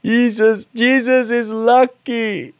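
put-on high, slow child's voice; little boy voice. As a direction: voice a small boy in the back of the car, sweetly. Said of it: "Leon said from the back".

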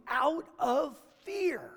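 whisper. Acted. Hushed end of sentence.

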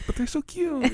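put-on high cooing baby voice. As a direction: coo it like a cute pet. Cooing over baby pig.

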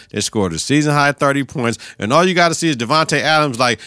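ordinary delivery

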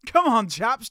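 ordinary talk

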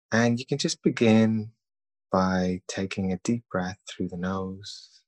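calm, even meditation voice with long pauses; guided meditation. Acted. Leading listeners through breathing and self-thanks, calm guided meditation. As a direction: guide them gently, leaving long silences between phrases.

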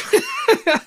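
loud, high-pitched burst of laughter. Real, not acted. Co-host cracks up after refusing, high laugh.

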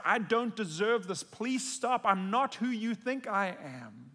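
a touch of tearful pleading; pleading cry. Pretend. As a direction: beg him to stop, ashamed. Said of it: "I want to push away and cry out".

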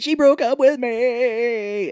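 high, drawn-out mock cry; mock wail. Friend retells the jilted man's line, mock wail.

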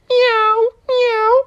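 very high sweet kitten voice; cute kittens. Acted. Kittens greeting the frogs, very high and sweet. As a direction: meow like a tiny kitten.

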